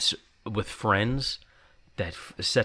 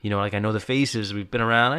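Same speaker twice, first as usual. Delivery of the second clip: ordinary talk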